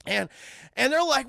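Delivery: plain reading voice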